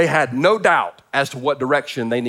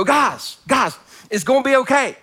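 high-pitched plea to calm down; exasperated reassurance; 9 semitones higher than usual. Acted. Preacher calling to the panicking Israelites, exasperated reassurance. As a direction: plead with them, voice pitched up.

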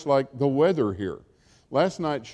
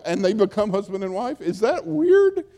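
incredulous; 7 semitones higher, 4 dB louder than usual. Real, not acted. Preacher marvels at performative words.

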